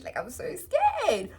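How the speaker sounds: high, slow put-on fright; whiny faked fear; mock scared. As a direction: act scared of the cat, slowly. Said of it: "I'm just like".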